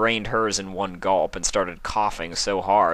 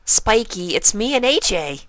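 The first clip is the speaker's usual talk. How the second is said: very high, bubbly pony voice; Pinkie Pie voice; 6 dB louder than usual. A narrator's reading. High bubbly pony voice. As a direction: Pinkie calling through the door, high and bouncy.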